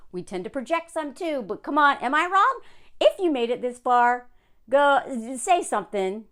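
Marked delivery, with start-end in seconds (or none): none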